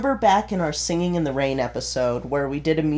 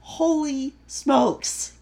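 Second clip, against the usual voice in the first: amazed; 9 semitones higher, much slower than usual. Real, not acted. Amazed at download countries.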